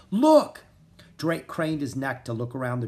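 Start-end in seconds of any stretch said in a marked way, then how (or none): 0.1-0.6 sudden loud high call; cry out. Acted. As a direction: shout to warn the others. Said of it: "Rory cried out".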